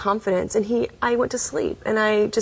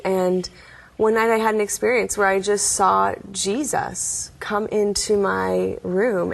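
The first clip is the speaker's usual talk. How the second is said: ordinary talk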